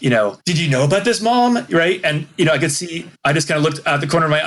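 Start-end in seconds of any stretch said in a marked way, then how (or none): none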